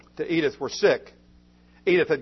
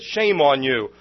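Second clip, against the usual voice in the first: joyful scolding; 6 dB louder, slower than usual. Acted. Edith scolds the sad doctor.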